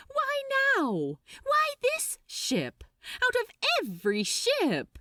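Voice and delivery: Crowley can't believe his ears; stunned disbelief. Acted. Crowley's stunned inner protest.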